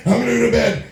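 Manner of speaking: lightly hammed impression; imitating Gary Busey. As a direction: ham up a sleepy announcement. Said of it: "So he gets up and says".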